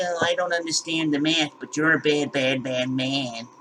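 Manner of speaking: mock the critics in a soft sneering put-on voice. Mocking the online critics who attack him, lightly voiced sneering whine. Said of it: "these dummies attacking me".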